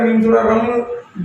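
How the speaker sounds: ordinary talk, nothing marked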